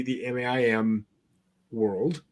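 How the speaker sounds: usual speaking voice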